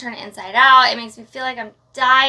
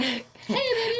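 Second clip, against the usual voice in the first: higher, softer flirty purr through a stiff jaw; mock flirty greeting; 10 semitones higher, 6 dB quieter than usual. Jaw stuck, flirting at the camera anyway. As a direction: play it sultry despite the pain. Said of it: "it's 'cause I'm like".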